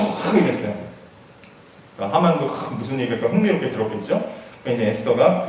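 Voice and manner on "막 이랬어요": ordinary talk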